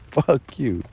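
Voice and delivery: a mock high defiant "fuck you"; defiant high snap. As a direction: spit it out like an insult. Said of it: "I'm like".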